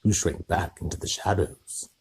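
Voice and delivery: plain reading voice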